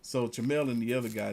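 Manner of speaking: ordinary delivery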